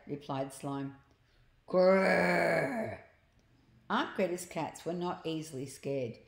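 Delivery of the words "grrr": loud, drawn-out "grrr"; put-on playful growl; attempted dog growl. A narrator's reading. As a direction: growl like a dog trying to scare cats. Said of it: "Growl at them".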